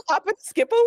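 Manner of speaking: high, loud teasing through laughter; laughing. Real, not acted. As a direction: toss in the joke while cracking up. Joking along with co-host, laughing.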